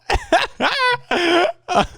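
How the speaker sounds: high howling cry breaking into a laugh; howling like a wolf pup. Real, not acted. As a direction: howl up high then laugh. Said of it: "I'll give a little howl".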